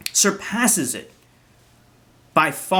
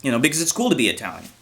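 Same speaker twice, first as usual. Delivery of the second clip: normal talking voice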